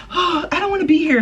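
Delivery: put-on high whiny child voice; mock tantrum kid. Imitating a kid in a temper tantrum, high and whiny. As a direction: whine like a sulking child. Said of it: "when a kid having a temper tantrum".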